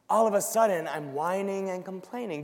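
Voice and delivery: ordinary talk, nothing marked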